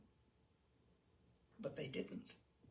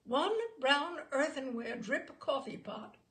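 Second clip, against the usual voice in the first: usual speaking voice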